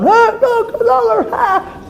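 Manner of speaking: very high, squeaky find-a-prize voice; hammed-up high-pitched delight; delighted passer-by. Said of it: "Another person passes".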